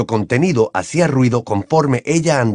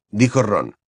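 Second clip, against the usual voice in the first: plain reading voice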